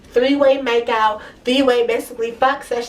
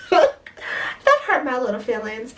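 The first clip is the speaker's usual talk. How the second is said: laughing; much slower than usual. Real, not acted. Laughing about being hurt.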